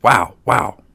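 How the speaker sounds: two punchy, woofing words; barking dog. A narrator's reading. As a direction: answer only in barks. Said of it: "The dog, however, answered nothing but".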